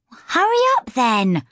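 high-pitched call over the shoulder; calling back. A narrator's reading. Older sister calls over her shoulder to her little sister, calling back. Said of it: "she called over her shoulder".

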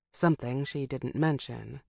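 ordinary delivery

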